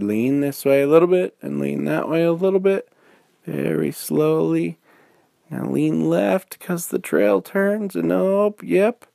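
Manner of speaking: drawn-out encouraging bear coach; coaching while running. Acted. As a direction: call directions to a wobbly rider. Bear coaching from behind bike.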